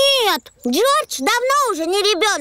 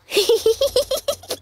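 cheeky giggle; 3 semitones higher than usual. Acted. Peppa hopes car breaks.

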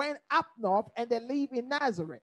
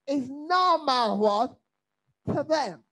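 normal talking voice

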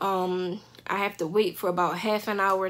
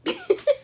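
short high burst of laughter. Real, not acted. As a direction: laugh at the thought. Laugh mid-story.

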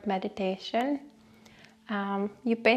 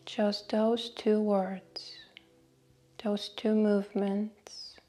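guided meditation. Acted. Host leading listeners through a short breathing meditation, guided meditation voice. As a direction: guide them unhurriedly, letting each phrase settle.

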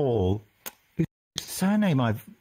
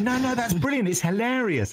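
high, gushing mock praise; delighted approval; 9 semitones higher than usual. Director approving the actor's monster voice, imitated delight. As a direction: act out a thrilled director, pitched up.

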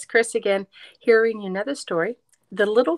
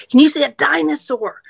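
loud; 6 semitones higher, 11 dB louder than usual. Acted. Loud question to kids.

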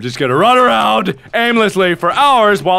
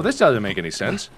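ordinary talk

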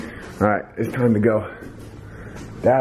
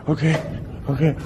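usual speaking voice

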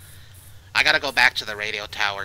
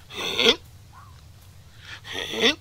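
high-pitched mimicked hiccup; imitated hiccup; 13 semitones higher than usual. Player imitates the hiccup heard in the woods, high hiccup. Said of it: "I heard a hiccup, like".

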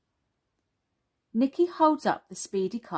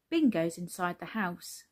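usual speaking voice

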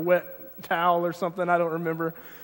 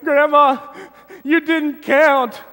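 put-on much higher, slow, wailing child protest; crying child; 9 semitones higher, 5 dB louder, much slower than usual. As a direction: whimper it out like a sobbing little kid. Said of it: "I'm crying and crying. I'm like".

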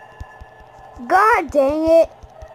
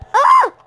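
high, loud startled scream; 6 dB louder than usual. Real, not acted. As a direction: shriek at the jump scare. Jump scare in horror game.

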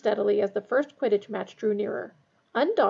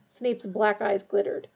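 ordinary delivery, nothing marked

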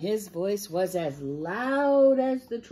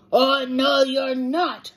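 loud, raised troll voice; yelling troll; 6 semitones higher, 7 dB louder, slower than usual. A narrator's reading. Troll refuses to let the smallest goat pass, yelling. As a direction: yell it as the bullying troll. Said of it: "he's yelling at him".

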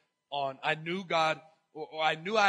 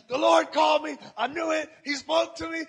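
high, loud, thrilled outburst; bursting with excitement; excited shout; 11 semitones higher, 9 dB louder than usual. Retold or imitated. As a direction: shout the news like it can't wait. Retelling his excited call.

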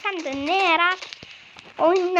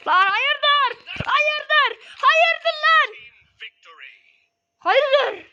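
high, loud "what's your problem"; indignant shouting; 9 semitones higher, 4 dB louder, much slower than usual. Real, not acted. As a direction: challenge someone angrily. Confronting an attacker.

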